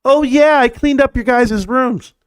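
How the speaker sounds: high, slow breezy mom voice; imitating his mom. Streamer imitating his mom about tossing his cards, high breezy voice. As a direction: play it innocent and high like mom. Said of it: "My mom went like this".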